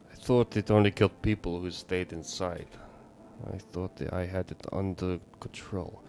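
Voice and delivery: put-on grave neighbor with a Russian accent; bad Russian accent. Neighbor explains pale thing's killing, bad Russian accent. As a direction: recount it in an amateur Russian accent. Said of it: "bad Russian accent right now for your neighbor".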